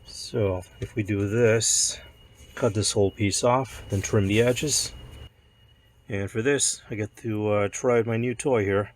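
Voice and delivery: hoarse croaky voice from a cold; croaky, a bit sick. Real, not acted. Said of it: "ignore the, uh, croaky voice".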